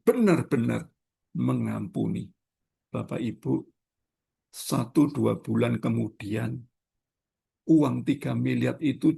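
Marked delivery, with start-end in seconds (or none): none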